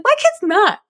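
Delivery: very high-pitched imitation of a mom; exasperated mom. Luca's mom contradicting her, exasperated. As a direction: re-enact an emphatic denial. Imitating upset mom's reply.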